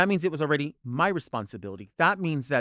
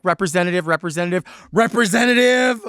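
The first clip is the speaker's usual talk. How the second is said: each representative louder and higher, last one a full shout; demanding a human agent, getting louder; repeated demand building to a shout; 4 semitones higher, 8 dB louder than usual. Retold or imitated. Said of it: "how loud or whatever".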